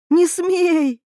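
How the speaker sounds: high-pitched indignant outburst; high, forceful maternal fury; angry mother's cry. A narrator's reading. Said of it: "крикнула мать".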